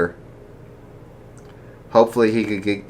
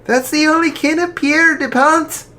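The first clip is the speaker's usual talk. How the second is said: daughter voice; 5 dB louder than usual. Acted. Daughter voice, read story.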